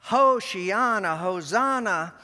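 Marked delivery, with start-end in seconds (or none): none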